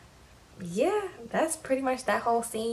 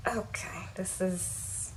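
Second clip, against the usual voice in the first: whisper; 8 dB quieter, much slower than usual. Retold or imitated. Awkward friend caught in fight.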